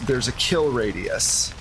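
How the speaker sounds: ordinary talk, nothing marked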